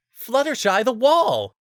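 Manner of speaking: loud, high urgent reminder; urgent call. A narrator's reading. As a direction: shout the reminder.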